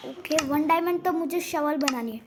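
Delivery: normal talking voice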